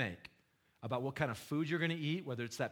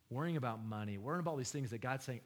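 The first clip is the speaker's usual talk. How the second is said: ordinary talk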